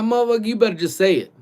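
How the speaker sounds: high, loud, rushed retort; exasperated demand. Retold or imitated. Retelling his impatient comeback to the maintenance dude, exasperated. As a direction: push it out fast and impatient.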